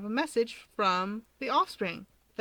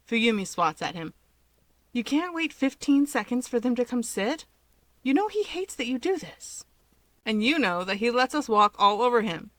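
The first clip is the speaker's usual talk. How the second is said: lively read-aloud storytelling; story narration; 5 dB louder than usual. A narrator's reading. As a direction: tell the story with a bit of energy. Reads fanfic story aloud.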